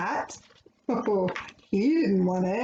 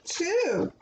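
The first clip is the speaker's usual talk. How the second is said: ordinary delivery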